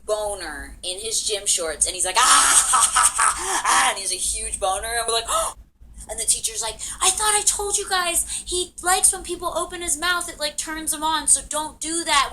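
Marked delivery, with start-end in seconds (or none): 2.2-3.9 loud, high mock shriek of laughter; imitated gleeful cackle. Imitates Evan's excited reaction. 5.3-6.0 high, sharp mock gasp; shocked gasp. Friends react in shock. 7.0-12.3 high, loud mock teacher voice; scolding teacher. Teacher scolds the two friends.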